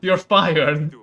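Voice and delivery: loud, furious firing; angry dismissal. Acted. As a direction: sack the cook at full volume. King fires the cook.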